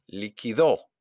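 "liquidó" punched on the last DÓ; stress on final syllable. Shown as a demonstration. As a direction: land hard on DÓ in "liquidó". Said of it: "hago un golpe de voz". ("liquidó" con golpe de voz en DÓ)

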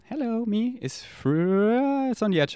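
high, small mock voice saying "hello, me"; a tiny scared ego popping up; voicing his physical mind. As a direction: squeak it like a timid little self. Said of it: "my physical mind".

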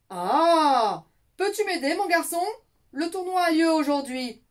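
a hint of a hearty dad; eager father. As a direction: call out to the son, keen for the tournament. Father voiced asking for help.

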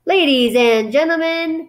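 high, slow announcer's call; grand public-address opening; fairground loudspeaker. A narrator's reading. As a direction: hail the crowd with stretched words. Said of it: "continued the loudspeaker".

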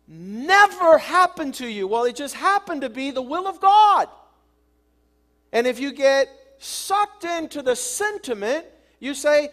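loud, high-pitched pulpit preaching; fiery preaching. Acted. Preacher driving home Peter's rebuke, loud and fervent. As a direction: preach it hard to the congregation.

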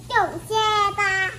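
speaking up slowly. Real, not acted. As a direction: say it up and slow for someone who can't hear. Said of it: "Không nghe, không nghe gì hết".

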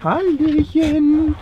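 very high, slow drawn-out hello; talking to a dog. Real, not acted. Greeting the dog.

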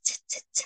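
ch, ch, ch as pure breathy puffs with no voice; airy ch demo. Shown as a demonstration. Teacher showing pupils the unvoiced ch sound. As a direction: blow out ch, ch, ch with only air. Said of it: "ví dụ như là ch".

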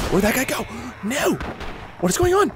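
high, startled yelling in a firefight; panicked shout. Real, not acted. Gamer panicking as enemies swarm him. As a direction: voice shoots up in panic.

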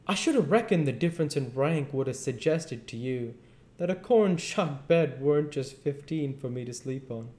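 high, pompous royal voice; haughty King. Acted. King demanding the better bed, pompous and high. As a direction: play the proud old King, offended. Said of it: "He says".

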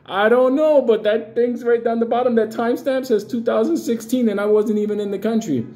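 high, insistent retold self-defense; acted protest. Retold protest to accusing agent.

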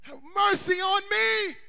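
high strained cry to the Lord; crying out for mercy. Retold or imitated. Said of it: "he cried out even the more".

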